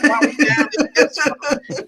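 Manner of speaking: high, slow, laughing puzzled partner; partner's puzzled voice. Retold or imitated. Partner asking why they came to the quarter, high and slow. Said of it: "And he's like".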